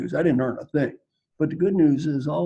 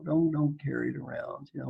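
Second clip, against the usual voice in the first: ordinary delivery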